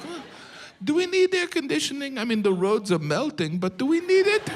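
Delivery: high, airy mock Spaniard shrugging off the heat. Comic mocking Spaniards who doubt air conditioning, high clueless voice. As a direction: pitch way up and play it clueless. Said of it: "You're like".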